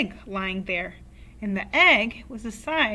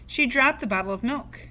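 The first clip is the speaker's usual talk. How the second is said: ordinary delivery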